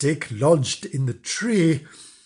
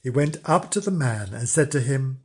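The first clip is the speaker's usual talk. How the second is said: ordinary delivery, nothing marked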